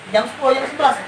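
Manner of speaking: usual speaking voice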